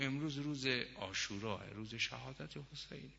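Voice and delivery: very soft, subdued explanation; hushed grief. Acted. Wife telling husband it is Ashura, hushed. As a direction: let the voice sink away in sorrow.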